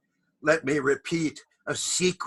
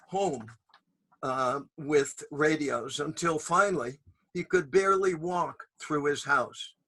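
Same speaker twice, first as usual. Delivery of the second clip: usual speaking voice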